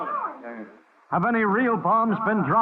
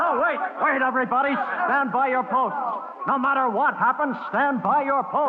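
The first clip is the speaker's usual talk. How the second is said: raised, urgent commanding call; 8 semitones higher than usual. Acted. Zone director calling the crowd to stay at posts, urgent raised call. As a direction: hold the crowd back with your voice.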